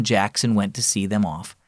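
usual speaking voice

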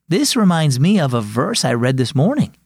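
ordinary delivery

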